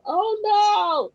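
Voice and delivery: high, loud alarmed cry; reliving the panic of being thrown out; panicked oh no. Retold or imitated. At the church, fearing she'll be kicked out, a high panicked cry. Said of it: "And I'm going".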